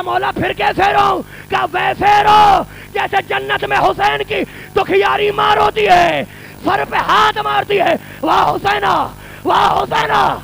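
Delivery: high, slow wailing cry; the Imam showing how to weep, wailing; keening lament. Acted. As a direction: wail as a mourning mother. Weeping instruction, climax of majlis.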